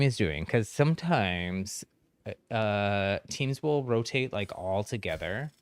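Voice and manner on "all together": ordinary talk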